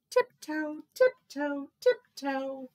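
high, evenly spaced little steps; dainty story-time sneaking; playful tiptoeing. A narrator's reading. Narrator acts tiptoeing.

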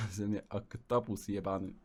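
usual speaking voice

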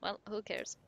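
ordinary delivery

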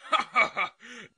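short burst of dismissive laughter; hearty laugh. Acted. Client dodging A mission charge, short hearty laugh. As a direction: laugh the question off.

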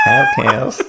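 laughing high-pitched American "Podcast" with flat a; echoing the American "Podcast" in a laugh; American accent. Shown as a demonstration. Copying his co-host's American "Podcast". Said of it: "Very American".

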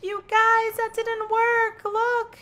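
high, loud dismay. Real, not acted. Telling viewers the date failed, high loud dismay. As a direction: cry out to viewers in disbelief.

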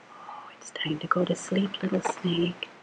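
soft unvoiced whisper; quiet bedtime whisper. Real, not acted. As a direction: hush the snake to sleep. Whispering the snake to sleep.

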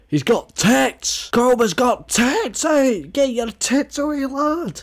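very high, slow shrieked disbelief; laughing shriek. Real, not acted. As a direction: squeal in shocked amusement. Shrieking at meme image.

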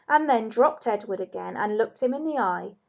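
usual speaking voice

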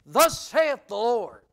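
booming and very slow, each word hammered out; thundering proclamation. Acted. Preacher voicing Paul's declaration to the church, thundering. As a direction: proclaim it like the voice of God. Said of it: "stand up and say".

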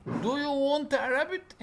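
slow, clear question with rising end; slow reading. Shown as a demonstration. Teacher reading the question slowly to the class.

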